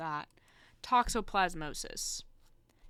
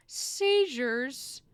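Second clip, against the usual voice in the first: alarmed emphasis; 5 semitones higher, 7 dB louder than usual. Real, not acted. Startled by the listed symptom.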